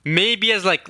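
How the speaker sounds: ordinary delivery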